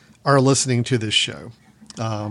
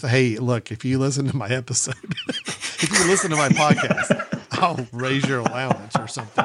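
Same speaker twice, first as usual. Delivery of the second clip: laughing bribe. Retold or imitated. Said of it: "tempting them with".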